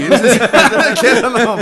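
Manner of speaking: loud, high yell breaking into laughter; lachendes Schreien. Real, not acted. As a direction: yell it drawn out while laughing. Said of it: "schon geht's los mit Schreien". (lachend laut und hoch losschreien)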